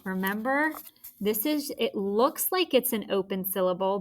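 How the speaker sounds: ordinary talk, nothing marked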